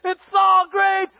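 high, loud cheering; hammed-up jubilant shouting; celebrating crowd. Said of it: "everyone starts celebrating".

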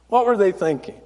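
understated incredulity; subdued disbelief. Real, not acted. Same question, dropped lower.

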